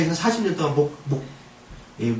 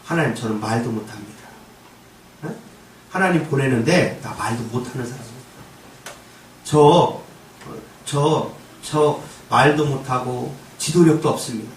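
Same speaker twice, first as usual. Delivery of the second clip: reluctant Moses; 7 semitones lower than usual. Acted. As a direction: beg off meekly, stammering. Moses pleading with God.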